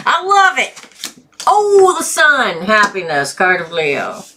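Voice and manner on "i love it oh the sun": delighted. Real, not acted. Delighted by cards drawn.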